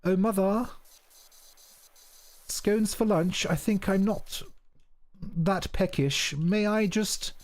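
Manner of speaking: high, mock-posh English refusal to Mother; hammed-up posh English, politely declining food; posh English accent. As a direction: put on upper-class English, pitched well up. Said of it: "not gonna speak like that anymore".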